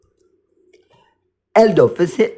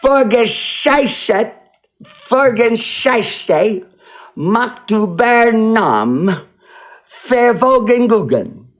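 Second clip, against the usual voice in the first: mock German; 5 semitones higher, slower than usual. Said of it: "What that means?".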